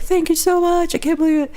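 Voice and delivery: high-pitched, overwhelmed gratitude; hammed-up grateful squeal; gushing thanks. Retold gushing thanks to worker.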